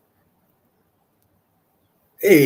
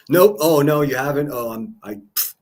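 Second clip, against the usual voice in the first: normal talking voice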